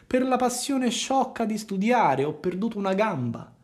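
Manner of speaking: lying fox. A narrator's reading. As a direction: feign a sad tale of a lost leg. Fox lies. (la volpe bugiarda, voce più alta)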